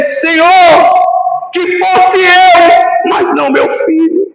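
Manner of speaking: loud, drawn-out plea to the Lord, stretching "eu"; father's plea. Retold or imitated. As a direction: cry out like a desperate father praying. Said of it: "aquele pai poderia dizer". (súplica de pai, alta e arrastada)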